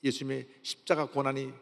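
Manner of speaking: normal talking voice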